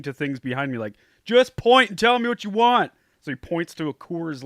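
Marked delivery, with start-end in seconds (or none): 1.2-2.9 high, loud shout over music. Retold or imitated. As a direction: yell it over a loud band.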